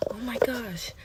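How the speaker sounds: gasped, whispered imitation of a teacher; gasp, then whisper in mock shock. Teacher who doesn't know what to do, whispering aghast.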